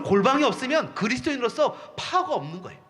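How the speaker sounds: ordinary delivery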